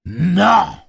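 high, loud cry of refusal; shouted refusal. A narrator's reading. Gâcheur guarding his scarf, shouting his refusal. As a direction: refuse outright at full voice.

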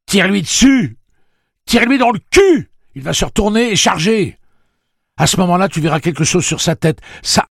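high, loud urgent command; ordre crié. A narrator's reading. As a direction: shout battle instructions over the din. Orders shouted over a bear's roar. (ordres criés avec urgence)

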